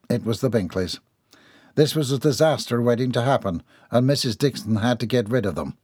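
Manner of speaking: plain reading voice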